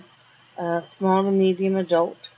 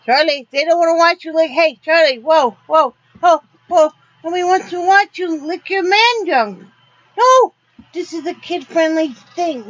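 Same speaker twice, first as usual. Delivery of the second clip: higher, louder, fast scold; scolding the dog; 6 semitones higher, 6 dB louder than usual. Real, not acted. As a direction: tell him off, embarrassed. Scolding dog licking on camera.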